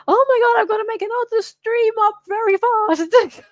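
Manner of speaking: high, loud mock panic with a whine; fake-cry panic over the rush; hammed-up frantic whine; panicked. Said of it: "I was like".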